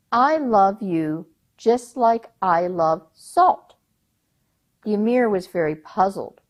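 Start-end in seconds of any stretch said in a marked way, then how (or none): 0.1-3.8 slow earnest answer. Acted. Youngest daughter's honest answer.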